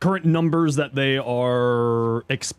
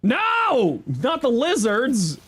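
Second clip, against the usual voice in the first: mock dismay; 10 semitones higher, much slower than usual. Mock dramatic outcry.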